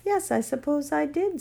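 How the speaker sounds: half-remembering, unsure of himself; voiced boy, a touch puzzled. A narrator's reading. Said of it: "with a puzzled air".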